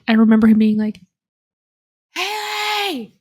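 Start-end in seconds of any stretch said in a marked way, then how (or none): 2.1-3.1 mock yell for someone, pitched way up; imitated call across house. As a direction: call out for a sister in another room. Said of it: "calling me from across the house".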